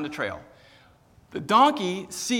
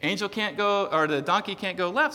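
ordinary talk

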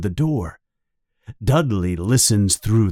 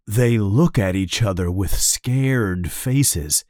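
ordinary delivery, nothing marked

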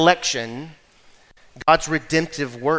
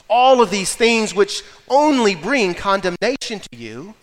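louder, higher gospel preaching; fiery preaching; 5 semitones higher, 4 dB louder, slower than usual. Acted. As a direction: preach it with rising fervor. Preaching builds to full force.

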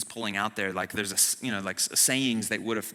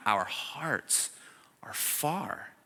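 hushed; 7 dB quieter, much slower than usual. Acted. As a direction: whisper "our hearts are far" almost unvoiced. Sermon point dropped to hush.

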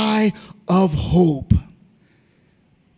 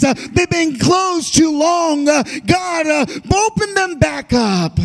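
fervent, raised preaching cadence; preaching at full force; 6 semitones higher than usual. Acted. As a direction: preach it out with a hoarse-free swell. Preacher leads crowd prayer.

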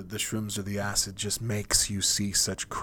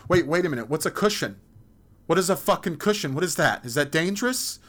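higher, louder, quick frantic questions; alarmed; 7 semitones higher, 6 dB louder, faster than usual. Acted. Confused person hearing of a cushion, frantic higher questions. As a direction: panic over the word cushion. Acted panic over cushion.

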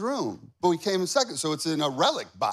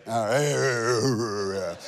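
a drawn-out Tim Allen-style "All right"; 7 semitones lower than usual. Retold or imitated. Preacher hamming up a Tim Allen impression for the dads. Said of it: "that's my Tim Allen right there".

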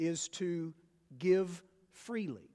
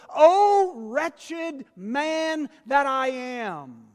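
mock lament; 6 semitones higher, 9 dB louder, much slower than usual. Paul's lament, preached.

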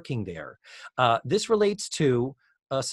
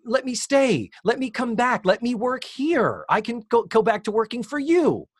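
much higher, pleading mock desperation; desperate Blair; 10 semitones higher, 4 dB louder than usual. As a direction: beg like a hammed-up desperate girl. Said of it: "starting to get desperate now".

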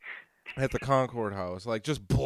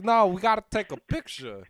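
high-pitched Walken impression; Christopher Walken; 6 semitones higher, 4 dB louder than usual. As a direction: insist in an oddly lilting celebrity impression. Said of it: "the owner looks like Christopher Walken".

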